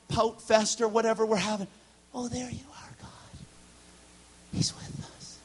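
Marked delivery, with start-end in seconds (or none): 2.1-5.4 soft, hushed whisper; whispered prayer. Acted. Believer finds God in a pout, hushed whisper. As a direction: breathe it like a secret. Preacher voices intimate prayer.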